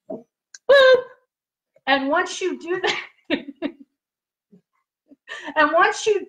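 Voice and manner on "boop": high, loud little boop; mock device boop. Shown as a demonstration. Host showing viewers how the connector clicks, a high boop. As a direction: chirp it like a gadget clicking in. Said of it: "and then you go".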